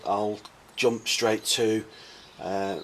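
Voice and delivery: usual speaking voice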